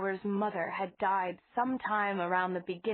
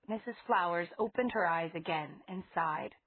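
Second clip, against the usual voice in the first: ordinary delivery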